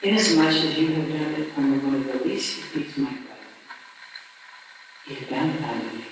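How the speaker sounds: the Lord answers the dying Artaban, low and hushed. Retold or imitated. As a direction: speak as a calm, far-off divine voice. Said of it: "heard the voice a little more distinctly answer".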